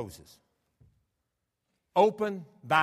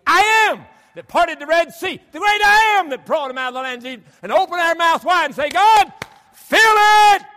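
full-force preaching; 19 dB louder than usual. Acted. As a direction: preach it to the rafters, building to a shout.

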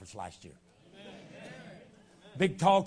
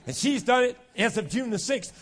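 ordinary delivery

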